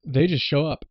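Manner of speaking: ordinary delivery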